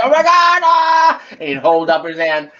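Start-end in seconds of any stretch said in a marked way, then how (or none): 0.0-1.2 high, loud pained yelp; burning scream. Retold or imitated. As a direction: yelp like your hand got burned. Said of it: "Doc would scream in agony every time it happened".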